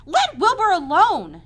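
loud, high, drawn-out telling-off; sharp rebuke. A narrator's reading. Spider defending the pig from the lamb, sharply. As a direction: come sharply to a friend's defence. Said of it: "she spoke sharply to the lamb".

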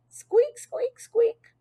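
very high little squeaks; high-pitched pet squeaking; hamster squeak. A narrator's reading. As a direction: squeak it like a tiny rodent. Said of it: "It probably sounded like".

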